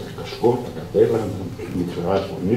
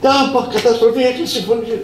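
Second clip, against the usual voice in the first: panicked squeal. Retold or imitated. Panicked boss.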